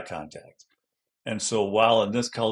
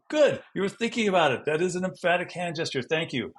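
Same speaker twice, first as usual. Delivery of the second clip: much higher, pleased approval; encouraging teacher praise; 8 semitones higher than usual. Acted. Teacher praising a child's gesture attempt, encouraging. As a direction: praise a learner's careful attempt brightly.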